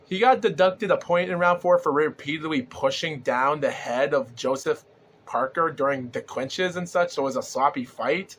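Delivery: normal talking voice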